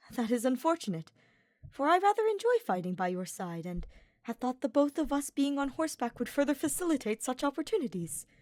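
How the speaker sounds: a touch of hurt, lifted; disappointed young nobleman. A narrator's reading.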